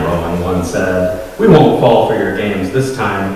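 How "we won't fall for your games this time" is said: ordinary delivery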